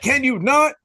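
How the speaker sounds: high, loud, put-on indignation; harsh mock snap. [name] telling off a creepy cop, mock-harsh. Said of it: "some aggressively harsh words with it".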